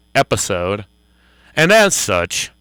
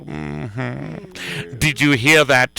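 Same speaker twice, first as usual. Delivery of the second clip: normal talking voice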